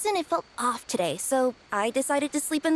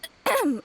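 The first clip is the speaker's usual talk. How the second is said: throat clear; 5 semitones higher than usual. Acted. Kirara covers her embarrassment.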